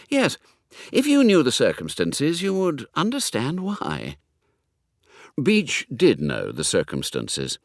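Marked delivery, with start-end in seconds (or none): 0.1-4.2 put-on higher, breezy young gentleman; lightly voiced young toff, confiding and cheerful; chatty young Hugo. Young secretary chats to the butler, higher and breezy.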